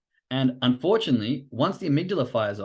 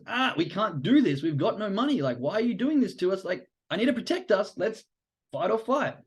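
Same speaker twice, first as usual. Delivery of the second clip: panicky amygdala; 7 semitones higher than usual. Acted. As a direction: play the amygdala pleading in mock alarm. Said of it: "your amygdala goes".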